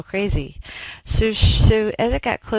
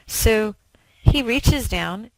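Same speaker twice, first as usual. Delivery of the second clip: ordinary delivery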